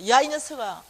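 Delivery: loud angry scolding of the son. Retold or imitated. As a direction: bark at the kid. 아들 야단침. (아이를 혼내는 화난 고함)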